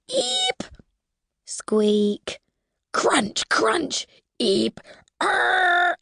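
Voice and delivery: squeaks, crunches and a growl; imitated creature noises. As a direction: mimic strange creature sounds. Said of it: "It was like this".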